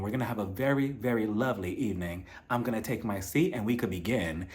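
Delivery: hushed, syrupy fake calm; soft-spoken mock voice. [name] greeting everyone in a mock soft-spoken voice. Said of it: "he's just talking in this whole soft-spoken voice".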